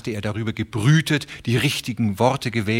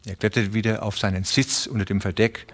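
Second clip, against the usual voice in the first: ordinary delivery, nothing marked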